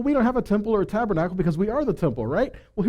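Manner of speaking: ordinary talk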